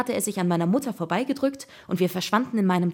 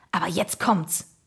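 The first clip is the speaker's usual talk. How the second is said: excited; slower than usual. A narrator's reading. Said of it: "rief Jakob aufgeregt".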